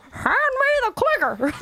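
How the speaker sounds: put-on high, slow old lady quote. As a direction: play a fussy old lady. Mocking old lady clicker user.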